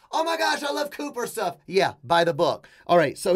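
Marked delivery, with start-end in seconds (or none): 0.1-1.6 high-pitched mock fangirl gush; gushing fan. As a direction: imitate an over-excited fan, pitched way up. Imitates a gushing fan of his.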